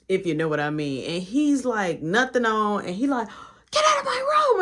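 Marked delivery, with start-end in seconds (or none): 3.7-4.6 put-on very high, crying child's yell; high, whiny child voice; whiny kid scream. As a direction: shriek like a caught little brother. Little brother screaming at intruder.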